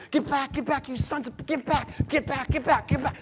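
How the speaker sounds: high, strained acted commands; rapid repeated orders to drive beasts away; frantic warding off. Man drives off butting lions.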